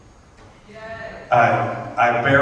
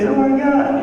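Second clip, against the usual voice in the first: gushing mother; 12 semitones higher than usual. Retold or imitated. Mother gushing in retold commercial.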